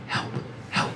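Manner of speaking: slow, soft, breathy dog plea. Retold or imitated. Dog on shrooms asking for help, slow and breathy. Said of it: "time slows down. So it sounded like he was saying".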